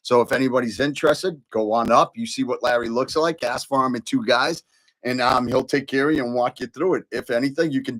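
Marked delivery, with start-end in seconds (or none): none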